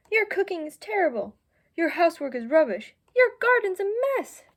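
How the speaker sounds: an escalating pet argument, each jab sharper; angry quarrel. Acted. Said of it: "fighting and very mad at each other".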